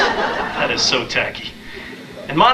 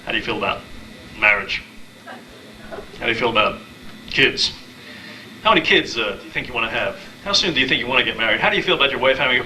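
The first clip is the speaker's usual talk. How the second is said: rapid-fire questions. Retold or imitated. Date firing marriage questions.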